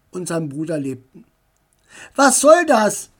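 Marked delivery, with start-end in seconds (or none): 2.2-3.1 loud, higher furious yell; shouting. A narrator's reading. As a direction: burst in yelling. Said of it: "schrie er". (laut und wütend geschrien)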